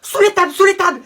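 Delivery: high, loud urgent repetition; frantic high-pitched command; panicked order. Acted. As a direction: yell for everyone to take cover. Urgent order during intruder alarm.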